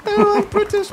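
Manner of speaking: high, plummy RP dog voice; high British. Pretend. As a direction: voice the dog as a very posh Brit. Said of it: "He's high British".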